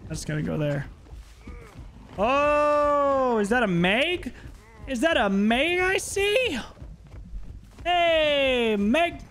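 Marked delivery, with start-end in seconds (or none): 2.2-9.2 high, playful creepy greeting; sing-song taunt. Acted. Killer spotting Meg, high sing-song creepy greeting. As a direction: lure her in sweetly.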